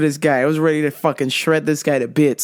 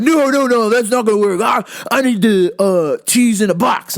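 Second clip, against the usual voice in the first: mock indignant protest, louder; irate customer; 4 semitones higher, 4 dB louder than usual. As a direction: object over the clerk's suggestion. Acted customer refusing loudly.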